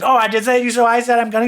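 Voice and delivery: loud, high mock outburst; blowing up. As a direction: imitate someone exploding in an argument. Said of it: "Candy blows up at Alexis".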